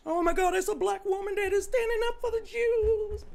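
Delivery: mocking critics in a high, sung-out voice; mock sing-song. Mocking her critics to the chat, high sing-song. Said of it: "mocking critics sing-song".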